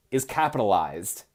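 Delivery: ordinary delivery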